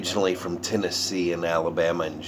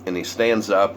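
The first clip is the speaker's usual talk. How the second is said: ordinary talk, nothing marked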